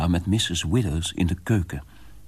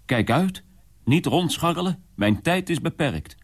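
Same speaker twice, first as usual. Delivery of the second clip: put-on brusque old landlord; 10 semitones higher than usual. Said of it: "riep hij".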